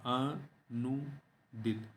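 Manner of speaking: very slow, soft "अनुदित" as a, nu, dit; syllable by syllable. Shown as a demonstration. Teacher sounding out the corrected word, slow split "अनुदित". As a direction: draw out "अनुदित" in separated syllables. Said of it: "अनुदित होता है".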